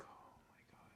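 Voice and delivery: near-silent whispered reaction; hushed shock. Retold or imitated. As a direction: whisper it, almost no voice left. Retold shock at hospital call.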